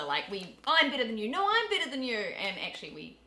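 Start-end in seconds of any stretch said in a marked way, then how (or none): 0.6-1.3 higher, louder playful boast; bickering sibling. Retold or imitated. Like brother and sister. 1.3-2.1 much higher, louder playful comeback; bickering sibling retort. Retold or imitated. Like brother and sister.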